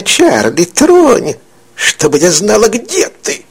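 a crone playing along with her trick; sly old witch. Old woman coaxing Charlie to touch her, sly crone voice.

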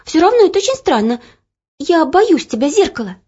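put-on fast, high, loud nervous girl; frightened. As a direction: blurt out your fear. Girl admitting fear to mirror.

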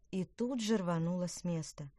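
plain reading voice

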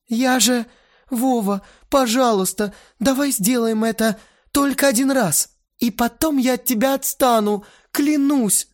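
high, desperate bargaining; begging girl. A narrator's reading. Lena bargains with Vova, high and desperate. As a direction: plead and swear, all in one breath.

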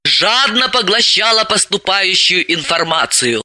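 ordinary delivery

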